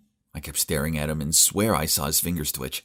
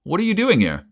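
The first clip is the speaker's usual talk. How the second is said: much higher, louder surprised question; 12 semitones higher, 5 dB louder than usual. A narrator's reading. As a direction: pitch it up in surprise. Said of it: "surprised greeting in story".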